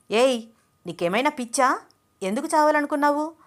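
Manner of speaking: angry shout, rising and rushing at the end; furious yell, pitch climbing; loud shout. A narrator's reading. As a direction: yell at the girl who tried to drown herself. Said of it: "గట్టిగా అరిచాడు వెంకట్".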